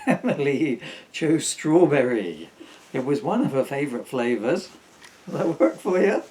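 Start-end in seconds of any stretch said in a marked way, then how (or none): 0.1-4.7 laughing. Real, not acted. Laughing restart of the line.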